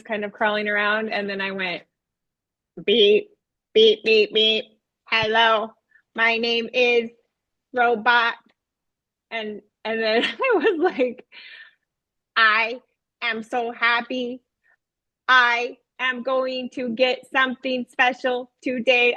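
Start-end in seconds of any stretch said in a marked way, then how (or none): 2.8-8.3 stilted robot greeting, evenly pitched, word by word. Retold or imitated. Teacher as a puppet robot greeting the children, robotic monotone. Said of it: "I talked like that". 12.4-19.1 slow, monotone robot, each word set apart. Retold or imitated. Teacher as robot puppet telling children its news, robotic monotone. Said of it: "I talked like that".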